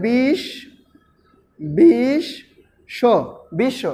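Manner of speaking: বিশ্ব broken as "bish", pause, "sho", then joined; doubled consonant. Shown as a demonstration. Teacher showing how doubling is pronounced, "bish... sho, bishsho". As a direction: sound out বিশ্ব in parts with the শ doubled. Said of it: "দ্বিত্ব কিভাবে উচ্চারিত হবে?".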